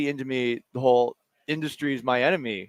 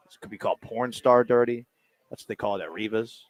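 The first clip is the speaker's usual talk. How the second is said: normal talking voice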